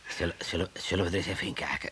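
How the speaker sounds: low, soft sneaky suggestion; hushed conspiratorial. Acted. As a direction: tempt him quietly to peek. Conspiratorial proposal to peek.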